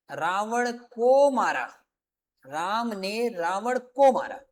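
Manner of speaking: very slow, stressing को; slow example. Shown as a demonstration. Grammar teacher to class, slow deliberate example. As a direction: spell out the example word by word, slowly. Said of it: "कैसे?".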